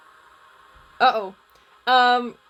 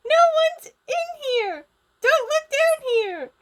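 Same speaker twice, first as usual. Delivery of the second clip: loud, very high-pitched squeal; panicked Gizmo; 5 dB louder than usual. Acted. Gizmo begs them not to look, panicked squeal. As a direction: squeal it like a dog whistle. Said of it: "I can't do a panic Gizmo".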